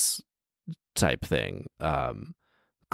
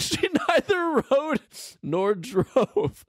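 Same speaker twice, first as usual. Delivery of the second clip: high, giggling disbelief; laughing squeal; slower than usual. Real, not acted. Laughing high disbelief reading text.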